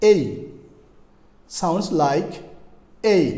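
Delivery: "এই" as a long gliding ay; drawn-out letter A. Shown as a demonstration. Teacher models the sound of letter A, drawn-out "এই". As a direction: say "এই" slowly, gliding into ee. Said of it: "তার মানে সাউন্ডটা হচ্ছে এই".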